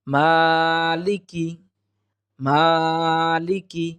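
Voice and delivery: "মালিকি" slow, long "maa", short "ki"; correct tajweed. Shown as a demonstration. Teacher modelling the one-alif stretch, careful tajweed. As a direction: stretch only the first syllable of "মালিকি". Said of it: "এক আলিফ পরিমাণ টেনে".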